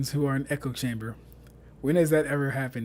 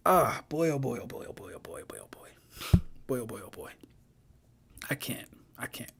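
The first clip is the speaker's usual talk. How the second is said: whispered dismay; 6 semitones lower, 11 dB quieter than usual. Real, not acted. Streamer despairing at a bad post, whispering to himself. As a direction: trail off into a low, whispered ramble.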